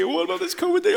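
whiny worrier. Pretend. Preacher mocks a congregant fretting about covid, high whine. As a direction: whine it like a frightened believer.

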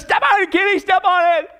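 high, urgent cry of panic; mock terror. Al to Kenny as he rolls up the window, mock terror. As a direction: urge the driver on in mock terror. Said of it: "in terror".

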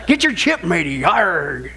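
hammed-up pirate pitch, growled "Arg". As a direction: play a cartoon pirate selling chips. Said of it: "It's a pirate".

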